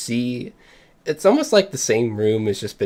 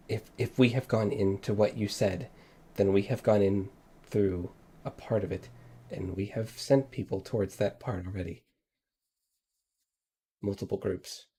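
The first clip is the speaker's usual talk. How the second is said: softer, dropping to near whisper; fading frightened explanation; quiet frightened voice; 9 dB quieter than usual. Acted.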